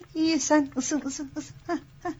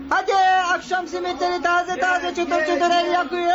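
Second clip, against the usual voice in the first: turkish street vendor; 4 semitones higher than usual. Acted. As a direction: shout to sell bread in the street. Simit seller shouting wares.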